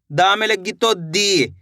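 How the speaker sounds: slow, stretched दी at the end; slow model reading. Shown as a demonstration. Teacher slowly modelling दा to दी. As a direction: read it slowly so pupils follow. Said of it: "खींच के पढ़ेंगे".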